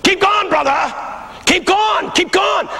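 high-pitched shouted encouragement; imitated men's yell. Men in the congregation urge preacher on, imitated yell. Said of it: "the men yell".

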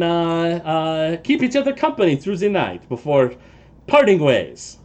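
put-on polite halfling with a light French accent; a lightly French-accented vintner asking to share the camp. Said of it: "like your accent".

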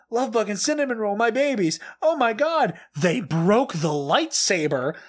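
high, lively dramatic reading of posts; frantic fan reaction. Acted. Host reads fan's reactions to friends, frantic. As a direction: read it like an overwhelmed fan.

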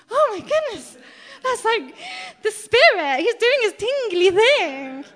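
high, loud, near-tearful rush of joy; thrilled gasp. Retold or imitated. Telling her gym friend, suddenly thrilled and teary. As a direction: overcome with delight at the Spirit.

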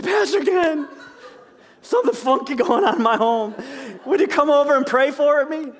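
high, laughing mock plea to the pastor; imitating a church member calling Pastor Ken at night; mock panicked member. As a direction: play a fretful member begging for help.

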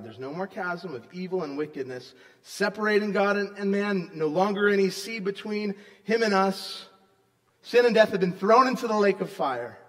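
loud, rising sermon proclamation; preaching at full force. Acted. Pastor proclaiming good news to congregation, loud and fervent. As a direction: preach it out with conviction. Reads scripture with fervor.

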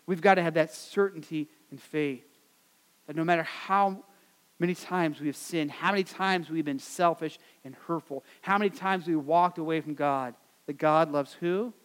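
ordinary talk, nothing marked

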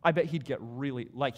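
ordinary talk, nothing marked

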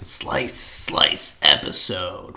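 deep, slow hype announcer; fighting game announcer. Pretend. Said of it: "sound effect announcer voice".

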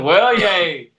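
loud, mock-cheery yay; a friend shuts him down and he shouts a mock-cheerful yay; sarcastic yay.

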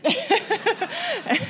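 high amused laugh; laughing at own awkwardness. Real, not acted. Preacher laughing at the tea mix-up, high laugh.

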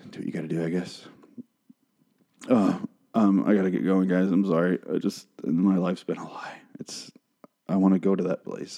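low, soft, defeated mumble fading nearly to a whisper; dejected. Acted. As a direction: sign off like a crushed, disillusioned fan. Disillusioned fan gives up, signing off.

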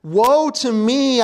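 slow, high, loud lament; crying out. Acted. Isaiah crying woe before the King, slow loud lament. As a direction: wail it like a prophet undone. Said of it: "I cried".

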